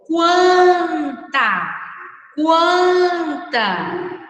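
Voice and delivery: "Quanta" stretched out, showing the U sound in kwan; model word. Shown as a demonstration. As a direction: call out "Quanta" so the kw is heard. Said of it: "Saiu o som do U".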